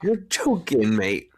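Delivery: high-pitched, put-on British exclamation; mock British accent. Host doing a favorite British line, mock British accent. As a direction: mimic British disbelief, starting high. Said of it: "when British people say is like".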